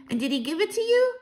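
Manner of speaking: put-on high-pitched, curious child's voice; eager child. Grandchild pressing Grandmama for the address, eager. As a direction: ask high with a rising lilt.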